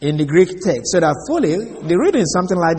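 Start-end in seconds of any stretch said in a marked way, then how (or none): none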